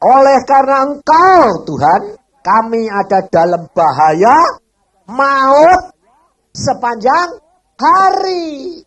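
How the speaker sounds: booming slow reading of the psalm; 1 proclaiming scripture. Real, not acted. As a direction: proclaim the verse to the church. Scripture recited in preaching.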